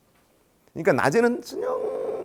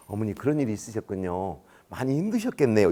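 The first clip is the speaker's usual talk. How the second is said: soft, lower soothing phone voice; sympathetic; 4 semitones lower, 3 dB quieter than usual. Acted. Seasoned official comforting upset parent by phone, sympathetic. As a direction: console the caller gently. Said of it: "지금 같으면 이렇게 전화를 받았을 거예요".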